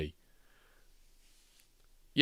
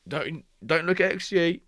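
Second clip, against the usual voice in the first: high loud protest; 11 semitones higher, 6 dB louder than usual. Real, not acted.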